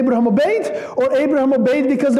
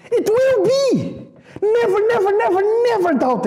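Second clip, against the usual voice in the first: voicing God, high and emotional; 10 semitones higher than usual. Preacher voicing God's promise to Abraham, high and tearful. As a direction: let the voice climb and break on never.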